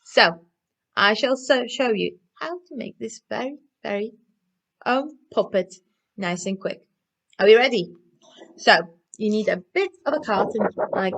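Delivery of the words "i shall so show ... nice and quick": usual speaking voice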